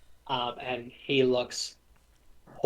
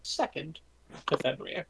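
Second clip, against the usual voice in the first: teasing god; 6 semitones higher than usual. Acted. Loki teasing about son rankings.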